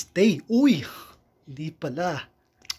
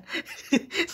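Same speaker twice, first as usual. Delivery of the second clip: high squealing laugh; 13 semitones higher than usual. Real, not acted. Reacting to card with laugh.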